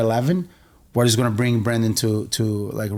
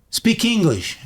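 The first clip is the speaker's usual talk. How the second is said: higher-pitched mock order; retold demand; 7 semitones higher, slower than usual. Stranger confronting a man speaking Portuguese on the subway, demanding.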